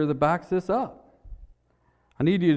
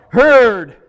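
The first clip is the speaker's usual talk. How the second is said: loud, higher single-word shout; yelled reply; 5 semitones higher, 11 dB louder than usual. Retold or imitated. Worker answering the boss's orders, yelled out. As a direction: yell it out like an eager crew worker. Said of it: "he would yell out".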